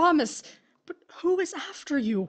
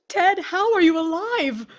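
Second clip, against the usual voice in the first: higher, louder, astonished; shocked question; 5 semitones higher, 7 dB louder than usual. Acted. Widow asking her dead husband, shocked. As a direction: demand it in disbelief.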